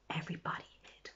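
quick hushed whisper. A narrator's reading. Teller whispering as everyone hides.

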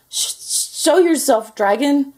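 stammering, high, loud challenge; stutter, then squeak out a slow command; nervous knight trying to sound bold; squeaky knight. A narrator's reading. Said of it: "squeaked a thin".

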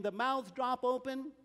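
usual speaking voice

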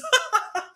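a sudden high squeal of laughter. Real, not acted. Podcaster cracking up at own joke, squealing laugh. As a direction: burst into a high giggle.

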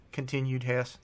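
plain reading voice

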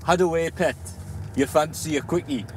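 Geordie line repeated. Shown as a demonstration. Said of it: "come again?".

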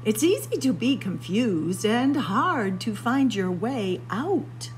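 ordinary delivery

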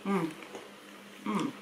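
speaking between bites; talking while chewing. Real, not acted. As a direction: mouth partly full of biscuit. Seen 1: Woman holds biscuit, bites it at 0.8, chews while talking.

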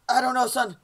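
flustered dad. Acted. Dad answering lost son, loud flustered reply. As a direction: answer loudly as an exasperated father.

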